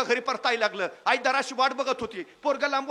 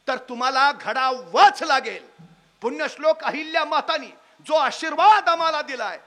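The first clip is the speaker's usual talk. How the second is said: louder, building sermon cadence; rousing preaching; 6 dB louder than usual. Acted. Speaker urges the audience to repay their debt, preaching loudly. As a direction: preach it to the crowd with rising force.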